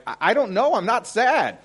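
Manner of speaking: high, loud, bewildered cry; panicked confusion. Retold or imitated. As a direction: sound lost and scared, voice jumping up. Retelling his confused panic attack.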